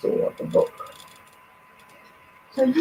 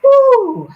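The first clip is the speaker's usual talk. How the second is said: high, loud "woo"; 8 dB louder than usual. Real, not acted. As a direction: let out a sudden high whoop. Exhales loudly in a lull between readings.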